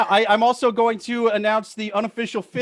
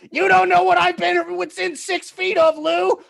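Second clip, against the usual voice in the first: mock menace, high-pitched; 9 semitones higher than usual. Mock threat, laughing, pitch far up.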